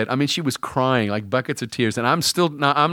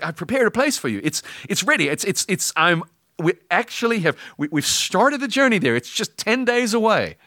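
high, eager, excited God; God bursting to share the gift; 8 semitones higher than usual. Acted. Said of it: "And God's like".

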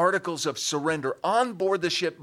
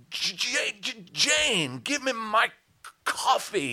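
hammed-up pulpit stammer and pauses; mock preacher delivery; 5 semitones higher, slower than usual. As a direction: preach a trivial request to your wife. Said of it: "I don't talk like that to her".

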